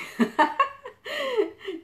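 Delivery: sudden high burst of laughter; a real mocking laugh, pitched high. Real, not acted. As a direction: cackle at the heckler. Mocking the heckler's anger.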